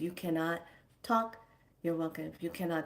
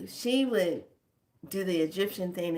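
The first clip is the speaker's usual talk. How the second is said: ordinary talk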